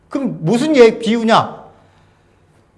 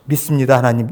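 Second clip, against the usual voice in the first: lower, simple "믿습니다, 하나님"; low sincere confession; 9 semitones lower than usual. Shown as a demonstration. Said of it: "좋은 말을 놔두고 왜". (낮고 담담하게 "믿습니다, 하나님")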